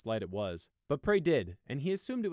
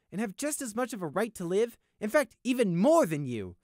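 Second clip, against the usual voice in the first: higher, louder stern scolding; angry lecture; 13 semitones higher, 7 dB louder than usual. Acted. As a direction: lecture him angrily. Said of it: "Sunshine told Prey".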